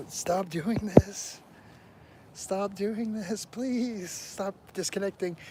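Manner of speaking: high, drawn-out plea; exasperated pleading. Real, not acted. Begging the Wi-Fi to stop disconnecting, exasperated pleading. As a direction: plead in a slow, high whine.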